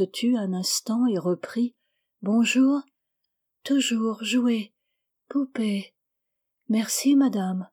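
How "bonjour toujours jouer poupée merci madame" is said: put-on slow, dreamy little girl's voice, fading to a whisper; a sick child murmuring in her sleep; voix douce de fillette. A narrator's reading. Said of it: "Sa voix était comme une musique de violon très douce". (la fillette malade parle en rêve, lente et douce)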